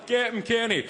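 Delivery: mock pub-fan call, pitched high; egging on. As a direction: urge your mate on like a rowdy fan. Acted pub fan egging on friend.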